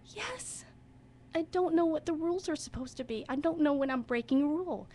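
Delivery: high, choked-up imitation of a distressed child; getting emotional. Child of divorce confiding in drama teacher, emotional. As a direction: let the child's confusion well up, pitched high. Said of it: "she started to get emotional".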